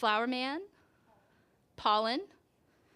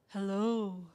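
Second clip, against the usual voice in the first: softer, lower hello like a faraway echo; 4 semitones lower, 3 dB quieter than usual. Pretend. Reading to a child, answering as the soft echo. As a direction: let it fade back as the echo. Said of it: "listen for an echo".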